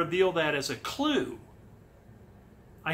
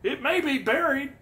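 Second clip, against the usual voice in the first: mock Fenn voice, pitched up high; typical Fenn; 9 semitones higher, 5 dB louder than usual. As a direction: imitate an old treasure hider protesting, high and loud. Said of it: "that's typical Fenn".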